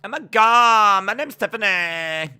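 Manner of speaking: high, loud mock female voice; Tiffany. Streamer overdoing a wrestler's introduction, loud exaggerated female voice. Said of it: "my pretty standard go-to modern female voice".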